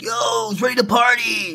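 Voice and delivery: high, whooping imitation of a drunk guy; mock a rowdy drunk hollering to party; drunk party crasher. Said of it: "He's, like, fucked up".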